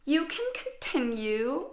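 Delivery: slow, lightly voiced sorority girl; a touch of sugary sorority condescension. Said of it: "in this toxic sorority girl voice".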